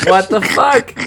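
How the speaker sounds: high, loud incredulous outburst; outraged disbelief. Retold or imitated. Manga reader venting about a sudden breakup, outraged disbelief. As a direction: burst out in sheer disbelief.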